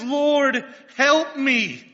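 loud, high desperate cry. Acted. Kneeling mother crying out to the Lord, loud and high. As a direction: cry out for help from your knees. Kneeling woman cries to Jesus.

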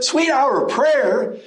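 incredulous echo. Real, not acted. Preacher scoffing at looking back, slow and incredulous. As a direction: repeat "Sweet hour of prayer?" slowly in real disbelief.

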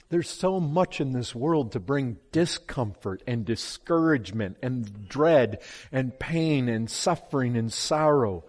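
usual speaking voice

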